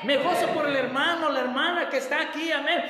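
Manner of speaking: loud, high rejoicing prayer; joyful prayer. Acted. Believer praising the Lord in church, loud and joyful. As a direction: pray out loud with joy.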